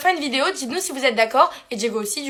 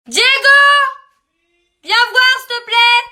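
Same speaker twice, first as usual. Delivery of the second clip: yelling across the room; 9 semitones higher, 4 dB louder than usual. Real, not acted. Calls Diego from another room.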